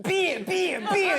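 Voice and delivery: high chant, falling on each word; mocked American women. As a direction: chant it in a high mock-woman voice. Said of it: "You know American women, they're like".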